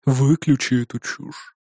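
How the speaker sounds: low, soft, slow tired command; weary order. A narrator's reading. Clan head telling driver to kill the radio, weary. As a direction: give the order slowly with weary disdain. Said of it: "устало вздохнув, произнёс Невзоров".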